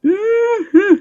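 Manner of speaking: high rising mm-hmm of pleasure; high savoring hum. Real, not acted. Savoring the food.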